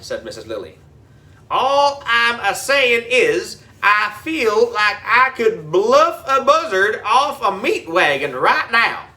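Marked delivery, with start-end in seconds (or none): 1.5-9.1 folksy country drawl. A narrator's reading. Groundskeeper folksy voice.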